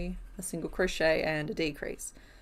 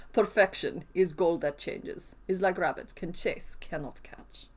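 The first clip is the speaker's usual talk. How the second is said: put-on Russian accent; 2 semitones lower, slower than usual. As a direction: read it like a quote in a slow Russian accent. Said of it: "read that quote in a Russian accent".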